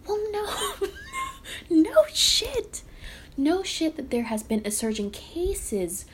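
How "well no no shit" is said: laughing outburst. Real, not acted. Vlogger scoffing at a headline, laughing then shouting. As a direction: laugh it off, then yell it out in disbelief.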